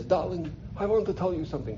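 put-on soft, raspy European old lady; European raspy aunt. Old aunt confiding in her nephew, European raspy voice. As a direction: voice an old aunt, hushed and accented. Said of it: "European raspy voice".

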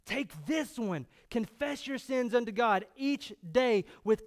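forceful, urgent sermon delivery; impassioned preaching. Real, not acted. Preacher urging the congregation about the gospel, impassioned. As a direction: drive it home to the congregation.

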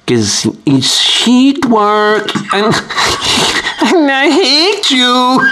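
high, slow, strained reversed voice; backwards speech. Shown as a demonstration. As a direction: choke the words out as if reversed. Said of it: "That would be backwards".

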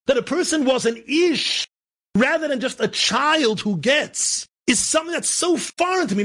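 high, drawn-out preaching cry; slow, weighty start rising into high exhortation; impassioned preaching. Real, not acted.

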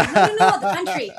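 loud, emphatic no no no, setting them straight; insistent correction. Retold or imitated. Correcting Americans who confuse Colombia with Columbus, loud insistent voice. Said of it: "y yo".

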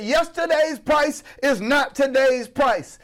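high, slow, emphatic proclamation. Retold or imitated. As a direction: proclaim it slowly with pitch pushed way up. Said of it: "[name] came on the next day and said".

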